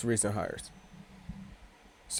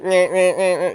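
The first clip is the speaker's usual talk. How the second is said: movie character impression; 5 semitones higher, 6 dB louder than usual. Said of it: "Grandma's Boy".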